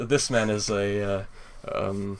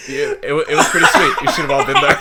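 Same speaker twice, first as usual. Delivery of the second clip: laughing Knuckles; 12 semitones higher, 13 dB louder, faster than usual. Acted. Voicing Knuckles retelling the party.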